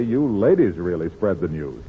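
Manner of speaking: ordinary talk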